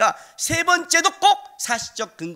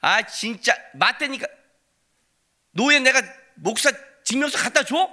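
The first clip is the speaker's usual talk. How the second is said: mock indignant insist; 5 semitones higher, 4 dB louder than usual. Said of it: "이렇게 말하는 거예요".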